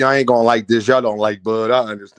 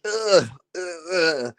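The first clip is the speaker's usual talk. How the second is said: bug creature groan; 5 dB quieter, slower than usual. Pretend. Shedding earth man skin, mock bug groans. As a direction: lightly grunt like a cockroach alien. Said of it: "get out of my earth manness".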